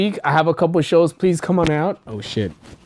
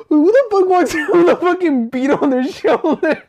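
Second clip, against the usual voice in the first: incredulous quote; 12 semitones higher than usual. Retold or imitated. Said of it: "He's like".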